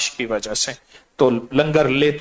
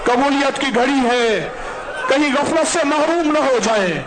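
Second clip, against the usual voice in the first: fervent preaching; 9 semitones higher than usual. Acted. Imam urging worshippers to weep while reciting, fervent. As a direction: urge them on with full force. Urges congregation at full force.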